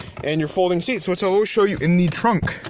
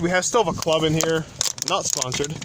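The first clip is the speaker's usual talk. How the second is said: usual speaking voice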